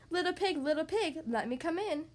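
usual speaking voice

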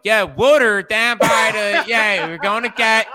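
loud, high, drawn-out Philly fan; hammed-up Philly accent, pitched high and loud; mock Philly accent. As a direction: holler like a caricature Philly local. Mimics local fans on camera.

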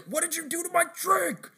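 hammed-up furious cartoon yell; angry customer. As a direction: yell it like a cartoon customer who's been pranked. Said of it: "the guy gets so angry".

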